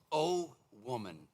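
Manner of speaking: slow, gentle O woman; a hint of Jesus speaking tenderly, slow; tender address. Shown as a demonstration. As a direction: say O woman slowly and kindly. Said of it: "I didn't recognize this before".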